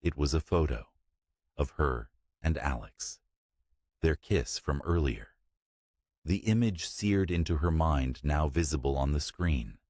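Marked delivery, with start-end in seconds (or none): none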